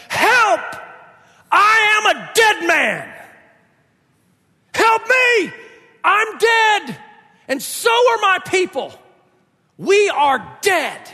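high-pitched, desperate shout; overwhelmed cry for help. Acted. Preacher as Isaiah overwhelmed before God, desperate shouting. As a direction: cry out as a doomed man, long pauses between cries. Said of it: "Isaiah is so overwhelmed that he says".

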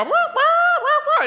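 very high, loud squawking rooster call; laughing rooster impression; mock rooster crow. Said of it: "the rooster was gonna go".

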